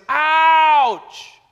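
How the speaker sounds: sudden high yelp of pain; a father hammering, hitting his thumb, shouting ouch; father's shouted ouch. Retold or imitated. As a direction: yelp it like you just hit your thumb. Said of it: "the worst word I ever heard him say was".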